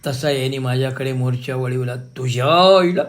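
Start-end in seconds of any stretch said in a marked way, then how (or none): 1.9-3.0 retold curse. Husband cursing her.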